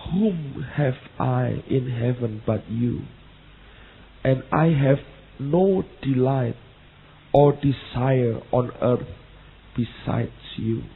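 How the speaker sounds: slow, solemn English verse reading; scripture reading. Acted. Preacher reads the psalm aloud to the congregation, slow and solemn. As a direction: read the psalm slowly, with reverence.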